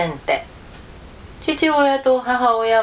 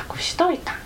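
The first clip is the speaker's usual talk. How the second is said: hushed storyteller whisper on the secret; 10 semitones higher, 4 dB quieter, faster than usual. A narrator's reading. Narrator whispering as Jiro hides the egret. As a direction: breathe it out as if telling a secret.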